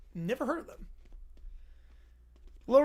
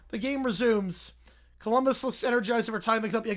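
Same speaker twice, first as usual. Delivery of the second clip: usual speaking voice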